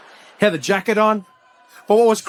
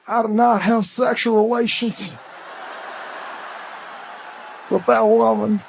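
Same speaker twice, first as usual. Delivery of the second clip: slow, seductive politician's drawl; mock-seduce the audience, drawing it out; Bill Clinton; slower than usual. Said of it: "sounded like he was trying to seduce the audience".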